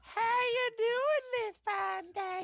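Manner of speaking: high, slow Southern drawl. Acted. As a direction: stretch it out like a Southern lady. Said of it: "acting Lori southern".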